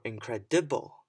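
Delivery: "incredible" with the DI punched loud; stress on third syllable. Shown as a demonstration. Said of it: "I stressed the different parts".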